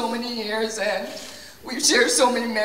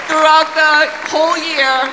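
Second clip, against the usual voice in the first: much louder and higher, projecting over the crowd; calling out; 4 semitones higher, 7 dB louder than usual. Real, not acted. Teacher thanking dancers over applause, loud and high. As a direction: call it out to the whole stage.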